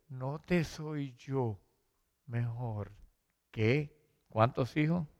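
lightly voiced loving husband; tender husband. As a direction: comfort her gently, almost hushed. Loving husband comforts Ana.

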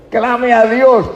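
high, loud, drawn-out cry; urgent exhortation. Real, not acted. Preacher urging a doubter to pray, crying out. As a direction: call it out high and slow.

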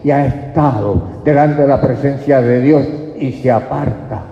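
preacher building to a loud warning; rising preaching. Acted. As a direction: drive the warning home. Preaching build over music.

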